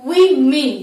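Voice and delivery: উইমেন said loudly as wi-min; English pronunciation. Shown as a demonstration. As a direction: punch out উইমেন, wee then min. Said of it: "কিন্তু ইংলিশে বলবো".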